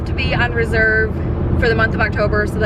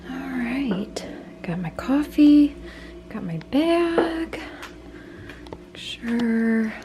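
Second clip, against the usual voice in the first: hushed early-morning whisper; 6 semitones higher, 7 dB quieter, slower than usual. Real, not acted. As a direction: whisper so nobody wakes up. Early morning, quietly leaving.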